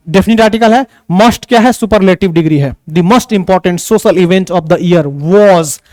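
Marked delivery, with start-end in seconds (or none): 2.9-5.8 very slow, "most" punched; stress on most. Shown as a demonstration. Teacher reading the example, stressing "most". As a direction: hit the word "most" hard and slow. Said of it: "emphasise करके बोले हैं".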